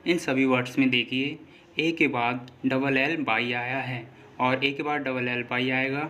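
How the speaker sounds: normal talking voice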